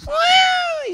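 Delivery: a sudden high shouted trumpet cry; elephant trumpet call. Retold or imitated. The preacher imitates the elephant's trumpet while naming animals. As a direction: blast it out like an elephant calling. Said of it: "na hora que ele faz assim".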